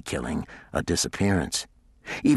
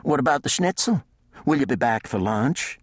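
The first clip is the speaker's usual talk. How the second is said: higher, lightly voiced housekeeper; 8 semitones higher than usual. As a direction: answer like a helpful housekeeper. Sandy asks about lunch.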